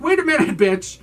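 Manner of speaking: high, loud mock indignation; mock outrage. Guests confronting their hostess over no water, mock outraged. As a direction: call the hostess out, hammed-up.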